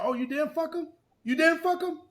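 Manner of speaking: retelling a girl accusing her friend, high mock outrage. Accusing girl quoted in argument.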